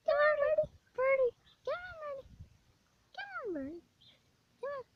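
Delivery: very high, cooing call to a bird; coaxing a wild bird. Real, not acted. Kids coaxing a bird to respond.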